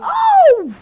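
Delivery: sudden high loud "Oh!"; shocked gasp. Real, not acted. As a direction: yelp of shock. Shocked reaction to monologue.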